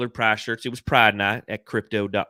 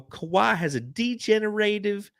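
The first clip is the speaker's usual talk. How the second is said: mock know-it-all poster; 9 semitones higher, slower than usual. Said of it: "and they go".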